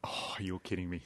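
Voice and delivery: breathy whisper of dismay. Real, not acted. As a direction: whisper it under your breath, caught out. Realises he forgot the potash.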